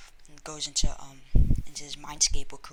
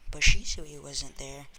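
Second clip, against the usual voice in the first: ordinary delivery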